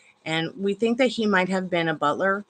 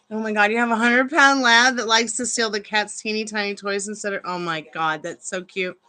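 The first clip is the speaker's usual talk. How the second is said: ordinary talk, nothing marked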